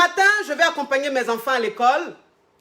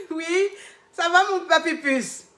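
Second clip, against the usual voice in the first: higher, bright and cheerful; 6 semitones higher than usual. Retold or imitated. Coach answering her client's call, higher and cheerful. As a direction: sound delighted to hear her. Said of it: "ma voix était gaie".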